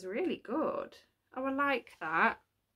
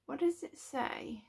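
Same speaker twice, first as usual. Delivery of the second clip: usual speaking voice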